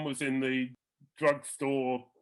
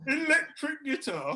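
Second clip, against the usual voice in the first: high mocking echo; mock old composer; 9 semitones higher than usual. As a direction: parody his disbelief. Echoing Copland mockingly.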